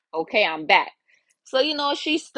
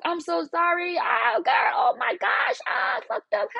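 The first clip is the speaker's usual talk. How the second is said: panicked exclaiming; 10 semitones higher, slower than usual. Retold or imitated. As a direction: cry out in high mock distress. Jenny exclaiming after third slip.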